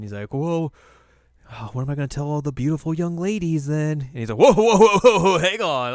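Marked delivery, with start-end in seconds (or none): 0.3-4.0 higher, mock-dismayed villager; hint of a put-on villager's plea; imitated villager. Acting out the villager tempting Moroku. 4.4-5.9 loud, high burst of whoas; mock frantic backtrack. Acting Moroku suddenly changing his mind.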